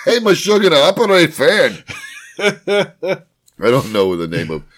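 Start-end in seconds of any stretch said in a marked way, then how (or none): 0.1-1.8 put-on bossy Yiddish accent to his AI. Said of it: "Where's this accent coming from?".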